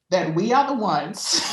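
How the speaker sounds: emotional laugh. Real, not acted. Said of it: "I'm feeling emotional".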